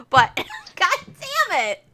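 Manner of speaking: high, loud laughter breaking through the words; cracking up at her own joke, can barely talk; laughing hard. Real, not acted. Laughing loudly at her own Scooby-Doo joke.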